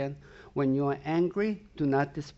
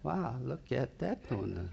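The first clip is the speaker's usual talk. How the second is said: hushed and softer, eyeing the treat; soft tempted murmur; 3 semitones lower, 8 dB quieter than usual. Acted. Preacher voicing temptation to the congregation, hushed and soft. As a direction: murmur it like temptation itself is talking.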